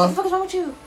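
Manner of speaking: high, rapid-fire scolding impression.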